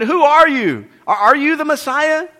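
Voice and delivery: high, loud demanding questions; questioning priests. Acted. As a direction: press him like suspicious priests. Priests questioning John, voiced dialogue.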